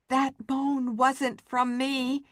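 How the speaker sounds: ordinary delivery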